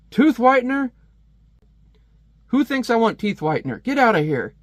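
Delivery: high, loud scornful stepfather; yelling stepfather. Acted. Stepfather yelling at TV commercials, high scornful outburst. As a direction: snap at the TV ad in disgust. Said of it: "just yelling".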